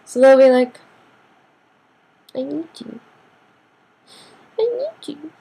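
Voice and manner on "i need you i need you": soft mock begging, climbing very high; puppy-dog pleading. Fake friend playing on your weakness, mock pleading. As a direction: plead like a puppy, higher on the repeat. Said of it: "it's like the puppy dog face".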